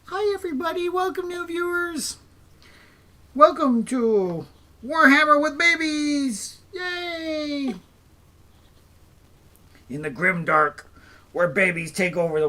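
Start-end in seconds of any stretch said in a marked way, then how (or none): 0.1-7.7 put-on high squeaky voice speaking for the baby. Dad voicing his baby to greet new viewers. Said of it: "Can you go, "Hi"?".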